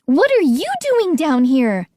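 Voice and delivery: high, quick outburst; blurted. A narrator's reading. Boy confronting Missus Bernard, blurted cry. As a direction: blurt it out before thinking. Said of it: "I blurted out".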